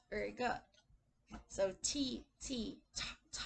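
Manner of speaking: ordinary talk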